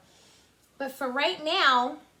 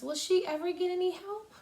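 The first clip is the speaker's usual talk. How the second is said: ordinary delivery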